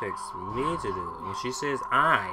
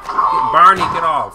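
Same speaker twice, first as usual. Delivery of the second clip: very loud, higher yell; panicked shout; 5 semitones higher, 14 dB louder than usual. Real, not acted. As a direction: scream at the animal to get off. Animal attacking in game.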